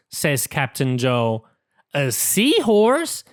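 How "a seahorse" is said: slow, rising, higher surprised ask; curious question. Acted. Pete asks the captain, slow and surprised. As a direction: Pete wonders aloud, eager. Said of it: "says Pete".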